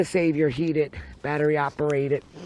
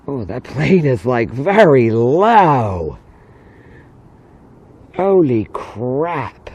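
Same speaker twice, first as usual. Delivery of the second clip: amazed; 4 semitones lower, 5 dB louder, much slower than usual. Real, not acted. Startled by low plane.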